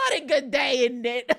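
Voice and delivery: a playful, lightly put-on British accent; mock British accent. Streamer hamming up a British accent for her chat, playful and half-laughing. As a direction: toss off a cheerful British greeting, half-laughing. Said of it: "I wish I could do accents so badly".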